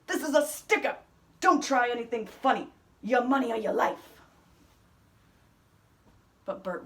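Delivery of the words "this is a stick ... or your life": higher, louder hold-up threat; a robber threatening the shopkeeper. Acted. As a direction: bark it like a stick-up man. Robber voiced.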